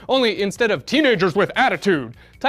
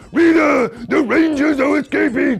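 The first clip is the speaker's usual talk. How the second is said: loud, high, shouting monster voice; 4 semitones higher, 4 dB louder than usual. Retold or imitated. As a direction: bellow it like a winged monster henchman. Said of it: "talked like this all the time".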